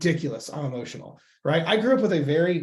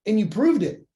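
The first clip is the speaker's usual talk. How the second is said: higher and louder, proud; excited brain voice; 6 semitones higher, 5 dB louder than usual. Retold or imitated. The brain proudly telling you it has control. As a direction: announce it like a triumph.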